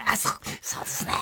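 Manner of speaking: whispered meek press-conference reply; mocking Watabe. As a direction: whisper it like a cornered apologizer. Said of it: "みたいなやつさじゃん".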